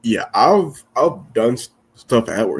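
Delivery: talking through hiccups; words broken by hiccups. Real, not acted. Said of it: "Excuse me, I got the hiccups".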